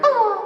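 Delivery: high mock yelp voicing the foot; foot screaming. The foot crying out after the kick, mock high yelp. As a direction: give the foot its own squeal. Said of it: "my foot went".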